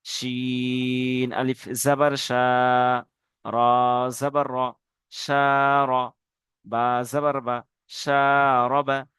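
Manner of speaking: each syllable cut apart and drawn out; slow separated spelling. Shown as a demonstration. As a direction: chant the letters one at a time, slowly. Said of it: "এরকম ভাবে বানান করবেন".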